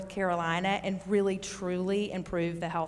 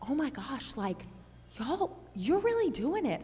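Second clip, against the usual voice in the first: higher, thrilled mock gush imitating a visitor; excited gushing; 4 semitones higher, 7 dB quieter than usual. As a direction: play up a delighted visitor's rave, pitched up. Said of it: "Christy with their team came up and said".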